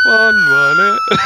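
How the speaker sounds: squealed high through laughter; screeching with laughter; shrieking laugh. Real, not acted. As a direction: shriek it while cracking up.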